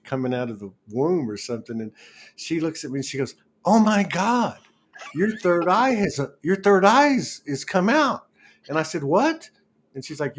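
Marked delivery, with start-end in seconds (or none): 3.7-8.3 high, excited retold exclamation; excited exclaim. Friend on the bus spotting her third eye, excited high exclaim. 9.0-9.5 high startled "What?"; surprised what. Retold or imitated. Hearing her third eye came out, high startled. Her baffled reply to friend.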